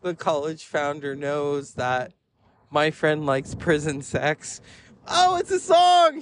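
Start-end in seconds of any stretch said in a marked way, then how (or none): 5.1-6.2 very high, loud mock-panicked shout; frantic explaining. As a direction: call out a desperate correction. Friend frantically explains to founder.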